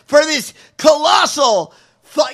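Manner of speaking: laughing disbelief. Real, not acted. Host ranting at the senator's excuse, loud and laughing. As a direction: let the outrage burst through a laugh.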